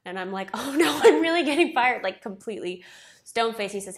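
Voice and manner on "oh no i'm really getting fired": higher, much louder panicked cry; higher and louder, imitating her own panic; mock panic. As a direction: blurt it out like you're sure you're fired. Panicked she is being fired.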